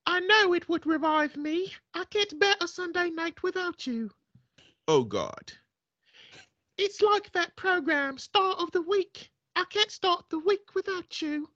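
hint of a posh English woman, doting; woman's British accent. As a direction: read it as a swooning English lady. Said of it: "I'm gonna do a man and a woman accent".

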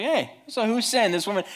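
insensitive disciple. Acted. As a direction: pipe up tactlessly, pitched high. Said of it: "the insensitive disciple who's like".

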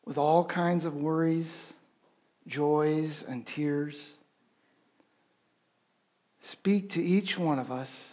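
earnest pastoral prayer, swelling a little on rouse us; heartfelt congregational prayer; spoken prayer. Acted. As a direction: pray over the congregation.

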